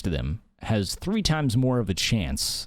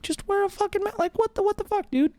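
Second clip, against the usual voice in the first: much higher, squeaky exasperation; exasperated outburst. Real, not acted. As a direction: let the frustration spike way up in pitch. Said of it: "I'm getting mad now".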